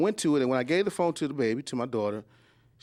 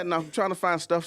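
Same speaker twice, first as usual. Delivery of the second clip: normal talking voice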